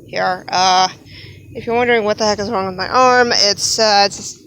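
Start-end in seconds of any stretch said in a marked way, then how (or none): none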